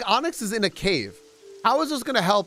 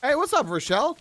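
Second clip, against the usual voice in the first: cheery greeting; 9 semitones higher than usual. Real, not acted. Greeting a villager.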